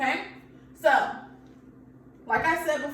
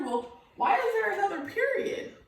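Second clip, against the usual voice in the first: puzzled student; 9 semitones higher than usual. Retold or imitated. Teacher voices puzzled student.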